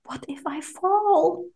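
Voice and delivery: slow, hushed, wondering doubt; timid quoted question. Retold or imitated. Podcaster voices the doubter in an English saying, hushed and hesitant. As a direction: draw it out softly, pitch lifting high.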